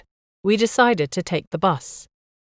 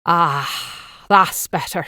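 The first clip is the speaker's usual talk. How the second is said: satisfied; much slower than usual. A narrator's reading. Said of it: "he exclaimed with great satisfaction".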